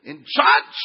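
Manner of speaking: loud, pompous burst on church; hammed-up holy indignation, punched out; mock religious shout. Churchgoer getting all religious at the pastor, mock pious shout. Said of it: "you got all religious".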